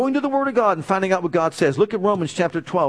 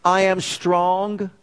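slow declaration; much slower than usual. Acted. Congregation response call declared.

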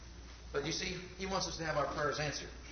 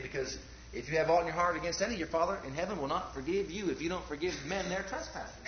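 ordinary delivery

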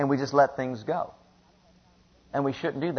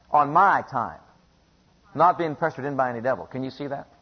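ordinary talk

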